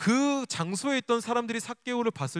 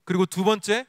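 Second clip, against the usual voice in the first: normal talking voice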